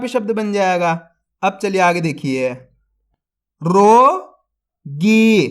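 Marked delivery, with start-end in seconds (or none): 3.6-5.5 रोगी drawn out very slowly, syllable by syllable; sounded out slowly. Shown as a demonstration. Teacher sounds out a word for young readers, very slowly. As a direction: stretch रोगी out slowly for the kids. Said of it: "तो मिलके बन जाएगा".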